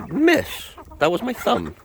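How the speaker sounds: very high startled yelp of a complaint. Real, not acted. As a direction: jump up in pitch as it bites. Bird pecked her thumb.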